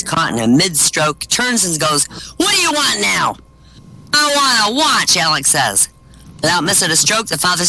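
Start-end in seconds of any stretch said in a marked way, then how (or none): 2.4-3.4 high-pitched, put-upon dad; exasperated father. Acted. Father to son walking in on him, exasperated. Interrupted father snaps in a joke. 4.1-5.2 put-on high, insistent kid voice; demanding little boy. Alex to his father in the bedroom, demanding.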